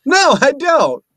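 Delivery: high, loud mock refusal; no way, said laughing; playful refusal. Real, not acted. Joking refusal.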